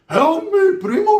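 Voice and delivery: high, loud mock wail; pained cry for help. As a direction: plead loudly in a high voice. Acted injured plea for help.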